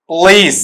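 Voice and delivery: पुलिस as one long "p'lees"; corrected pronunciation. Shown as a demonstration. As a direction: say पुलिस with hardly any first vowel, long ee. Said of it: "ऐसा ये sound वहां देगा।".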